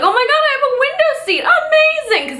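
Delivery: thrilled flyer spotting a window seat on the ticket; excited squeal. Retold or imitated.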